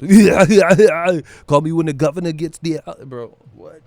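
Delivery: Arnold impression. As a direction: go full Arnold with a laugh. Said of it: "I'm just finna do the Arnold".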